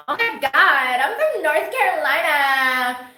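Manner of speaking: loud, high, slow hammed-up North Carolina accent; thick North Carolina twang. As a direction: stretch out a mock North Carolina twang for all to hear. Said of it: "lay it on thick and keep 'em confused".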